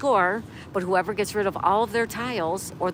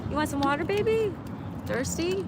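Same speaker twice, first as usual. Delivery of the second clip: pet talk; 9 semitones higher than usual. Real, not acted. Owner asking her dog about water, high pet talk. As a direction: lilt it up sweetly to the pet.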